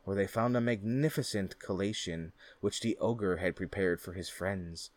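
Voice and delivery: plain reading voice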